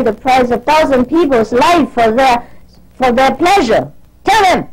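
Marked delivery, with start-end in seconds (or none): 4.2-4.7 high-pitched, firm command falling at the end; fed-up instruction to pass on; exasperated order. Real, not acted. Scolding absent disciples through a messenger.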